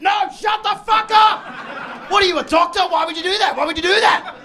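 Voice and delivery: high, loud screaming tirade; mock outraged wife. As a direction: shriek over him in disbelief. Acted outraged wife interrupting him.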